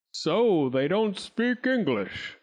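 slow, ponderous lord; Sir Richard's voice. Acted. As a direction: muse it out slowly.

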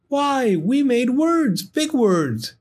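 high, amazed little creature; delighted firefly voice. Acted. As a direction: squeal it with wonder. Gus delighted by words.

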